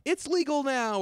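high, loud mock enthusiasm; hammed-up gleeful empty nester; empty-nester parent. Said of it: "empty nesters ... who are like".

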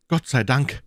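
aus tiefstem Herzen rufen, etwas lauter; "Gott sei Dank" erleichtert ausgerufen, Betonung auf "Gott", Ton steigt; erleichterter Ausruf. A narrator's reading. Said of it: "rief ich aus tiefstem Herzensgrund". (Watson ruft erleichtert aus, der Schatz ist fort)

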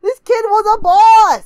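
loud, high, drawn-out admiration; awed, excited shout. Real, not acted. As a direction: proclaim it slowly at full volume. Exclaiming admiration for the kid.

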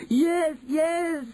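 high, insistent witch's shout. Acted. First witch insists to doubters, high shout. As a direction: insist at a high, excited pitch. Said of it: "shouted the first witch".

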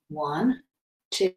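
normal talking voice

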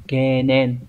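Kenen spoken alone, clear and deliberate, ke then nen; model reading. Shown as a demonstration. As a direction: say Kenen slowly and cleanly like a model for learners to repeat. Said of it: "Từ này sẽ đọc là kenen".

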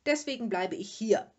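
loud, grumpy declaration; bad-tempered resolve. A narrator's reading. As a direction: announce it firmly, in a bad temper. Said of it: "fuhr der Vater fort und kratzte schlecht gelaunt". (laut und schlecht gelaunt)

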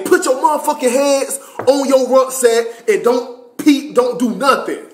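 high-pitched mock yell; retold yelled order. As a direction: bark orders as an imitated drill instructor. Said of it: "they yelling at me".

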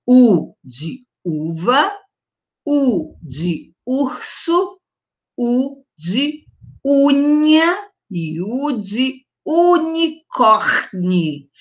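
slow "U de uva, U de urso", each "U" lingered on; U words spelt out. Shown as a demonstration. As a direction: give every "U" its own moment. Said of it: "Então".

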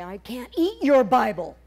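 a hungry man snapping back, half acted; indignant outburst. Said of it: "shake that Bible in your face and say".

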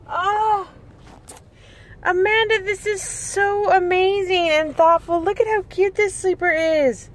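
high, bright-toned gushing over each piece; gushing delight. Real, not acted. Thanking Amanda for the baby clothes, high gushing delight. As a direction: coo over every little outfit.

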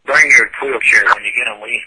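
ordinary talk

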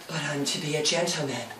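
very soft, low undertone; almost whisper it, low and mock-solemn; mock hushed earnestness. Pip about becoming a gentleman, mock hushed and low. Pip's retold modest line.

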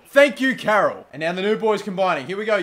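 usual speaking voice

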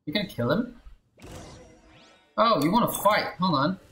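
ordinary delivery

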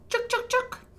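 high, loud chittering chuk sounds; squirrel chittering. Acted. Storyteller voicing the mama squirrel, high loud chitter. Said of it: "She chitters at them".

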